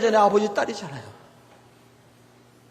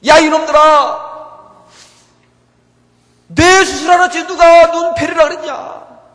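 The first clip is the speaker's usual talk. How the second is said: angry shout; 5 semitones higher, 10 dB louder than usual. Pretend. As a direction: yell it at the doctors like you want to tear into them. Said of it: "막 외치고 싶더라고요". (의사들에게 분노해 크게 외치듯)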